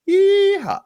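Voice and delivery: high, loud hammed-up yeehaw; mock cowboy yeehaw. Host teasing Arkansas with a hammed-up cowboy holler.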